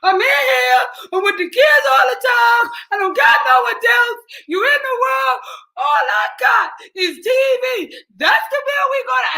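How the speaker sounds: high, loud, tearful pleading wail; mock crying wail. Wife begging husband to keep cable, tearful wail. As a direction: wail like the TV is being taken away. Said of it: "I started crying".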